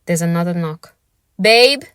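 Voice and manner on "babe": loud rising call from outside; loud call of Babe through a closed door. Acted. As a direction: call out for her. Boyfriend calling through door.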